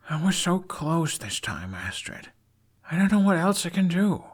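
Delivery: put-on a young man at his wits' end; despairing Hiccup.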